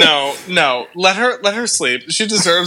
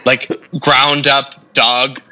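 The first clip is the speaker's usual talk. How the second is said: low slow emphasis; 4 semitones lower, slower than usual. Real, not acted. Gross exaggeration, low drawn.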